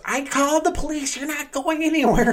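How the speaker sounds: very high, loud mock shriek; imitated screaming woman. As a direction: mimic her screeching a threat. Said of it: "She goes".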